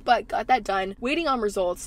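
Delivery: ordinary delivery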